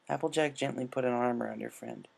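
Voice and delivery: usual speaking voice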